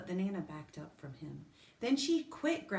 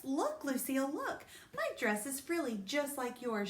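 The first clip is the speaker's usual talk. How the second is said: lightly voiced eager girl, higher; showing off; 8 semitones higher than usual. A narrator's reading. May showing Lucille her frilly dress, eager. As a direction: look at me, pitched up.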